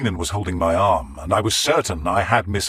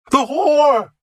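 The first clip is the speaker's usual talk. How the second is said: high, loud shriek of the word; raving monk's shriek; frenzied shout; 7 dB louder than usual. Acted. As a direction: scream it like a madman. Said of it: "he suddenly started shouting over and over".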